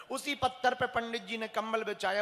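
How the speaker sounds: ordinary talk, nothing marked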